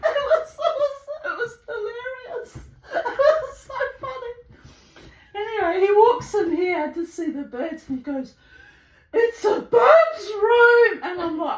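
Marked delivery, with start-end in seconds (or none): 0.1-4.3 high, helpless laughing speech; real laughter. Real, not acted. Recalling the neighbour's cat saga, laughing hard. Cracking up at own story. 9.1-11.0 high, loud, drawn-out amazement; acted exclamation. Visitor walking in to see the birds, loud slow exclamation.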